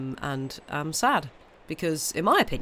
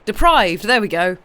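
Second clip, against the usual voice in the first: loud, higher burst of relief at the found word; real eureka shout; triumphant; 6 semitones higher, 12 dB louder than usual. Real, not acted. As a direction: blurt it out like you've won. Finally finds the lost word.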